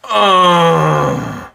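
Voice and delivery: slow despairing groan; slow exasperated moan. A narrator's reading. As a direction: let it sink into a groan. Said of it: "groaned Mister Brown".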